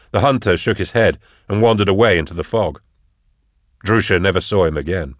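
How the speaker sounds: usual speaking voice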